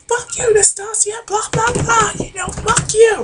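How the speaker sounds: very high, hammed-up squeal; squeaky mock cursing; mock angry shrieking. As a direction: imitate a helpless, furious tantrum. Acting a stuck woman cursing attacker.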